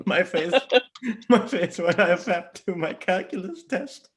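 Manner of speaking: high-pitched, breaking up with laughter; laughing. Real, not acted. Reading the story's punchline to a friend, laughing. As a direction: fight the giggles through the punchline.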